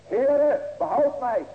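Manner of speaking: loud, high desperate plea; crying out for help. Retold or imitated. Peter sinking in the water cries to the Lord, loud plea. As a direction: call out to the Lord as if drowning. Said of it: "riep hij zeggende".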